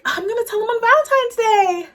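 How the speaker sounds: very high, loud mock announcement; mock declaration. Mocking own Valentine's Day promises, high loud declaration. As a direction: squeal it, imitating overexcited vows.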